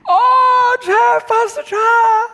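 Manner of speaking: shrieking high and loud with delight, drawn out; gushing excited call. Retold or imitated. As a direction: call out to him like an overjoyed fan across the street. Excited girl calling out pastor.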